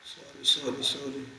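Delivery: very soft, under the breath apology; धीमे से माफ़ी; muttered sorry. Real, not acted. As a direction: mumble a quick sorry aside. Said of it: "अरे ये हम लोग कहाँ आ गए?".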